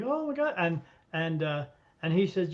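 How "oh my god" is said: a mock high-pitched amazed exclamation; light imitation of an astonished woman; amazed gasp. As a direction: play her stunned reaction lightly. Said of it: "she was like, all like".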